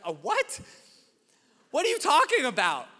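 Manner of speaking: high, loud "A what?"; shocked disbelief. Retold or imitated. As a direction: squawk it in disbelief. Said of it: "I was like".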